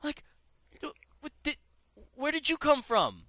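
high-pitched mock bewilderment; baffled surprise. Player reacting to the officer's sudden shouting, baffled high voice. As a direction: react as if thrown by the outburst.

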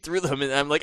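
ordinary delivery

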